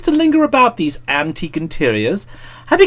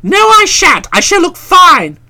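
loud insistence; 9 semitones higher, 6 dB louder than usual. A narrator's reading.

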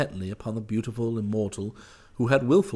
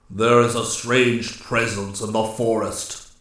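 slow, weighty woodsman's declaration; a forest ruler's measured pronouncement; grave Master Woodsman; 4 dB louder, slower than usual. A narrator's reading. Master Woodsman declares a strange presence to his nymphs, gravely.

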